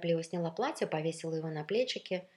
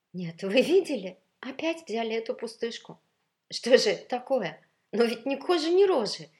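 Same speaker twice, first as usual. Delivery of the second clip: high, loud, appalled gossip; scandalized, sighing disbelief; indignant lament; 6 semitones higher, 10 dB louder than usual. A narrator's reading. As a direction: deplore a rival's looks, building to a high peak. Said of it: "вздохнула контральто".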